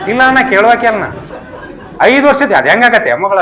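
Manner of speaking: high, laughing protest; laughing swami. Retold or imitated. Swami laughing off eating five years of food at once.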